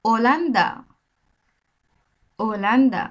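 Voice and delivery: ওলান্দা with a silent h, no হ sound; Spanish pronunciation. Shown as a demonstration. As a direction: say ওলান্দা clearly, dropping the h. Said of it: "উদাহরণ দিলেই বুঝতে পারবেন". (Spanish উচ্চারণে ওলান্দা)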